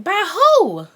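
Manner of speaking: high, loud auntie exclamation; mock demanding auntie. Auntie asking about the father before relatives, loud high demand. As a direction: imitate an aunt loudly wanting the name.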